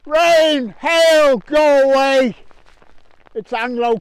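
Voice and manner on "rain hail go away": loud, high shout at the weather; mock command. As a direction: holler it out, stretching each word.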